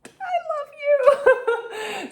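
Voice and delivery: very high, slow, laughing gush. Retold or imitated. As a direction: squeal it out while laughing. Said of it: "And I was like".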